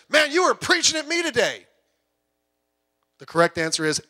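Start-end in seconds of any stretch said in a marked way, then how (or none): 0.1-1.7 mock complaining newcomer.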